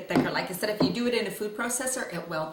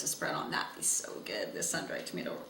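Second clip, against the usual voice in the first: soft whispered aside; whispering; 13 semitones lower, 8 dB quieter than usual. Real, not acted. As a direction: breathe it out as a whisper. Aside about the dip.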